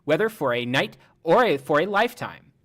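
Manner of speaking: TikTok robot lady. Retold or imitated. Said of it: "that TikTok lady's voice".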